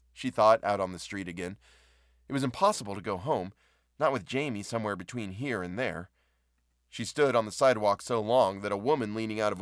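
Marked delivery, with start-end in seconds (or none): none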